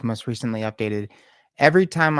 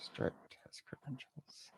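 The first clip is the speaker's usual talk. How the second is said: barely audible whisper; whispered to self; 3 semitones higher, 22 dB quieter than usual. Real, not acted. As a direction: whisper it back as if noting it down. Repeating the term to oneself.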